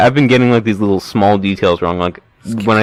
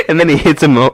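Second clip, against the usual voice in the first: ordinary delivery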